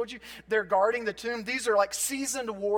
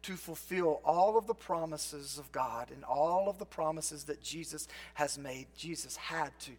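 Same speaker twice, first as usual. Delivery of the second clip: soft reflective preaching; 6 semitones lower, 7 dB quieter than usual. Acted. Quiet reflective preaching over music.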